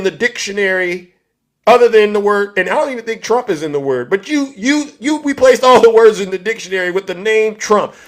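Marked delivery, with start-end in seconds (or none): none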